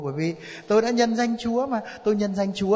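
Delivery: high-pitched pleading protest; insistent self-justification. Acted. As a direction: argue your way through heaven's gate. Acted plea at heaven's gate.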